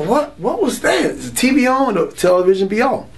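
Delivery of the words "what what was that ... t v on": high, slowed startled question. Retold or imitated. Waking up to voices in the dark, startled and alarmed. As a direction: jump awake and ask in alarm. Jolted awake by voices at night.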